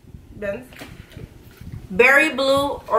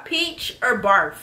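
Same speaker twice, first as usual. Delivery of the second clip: ordinary talk, nothing marked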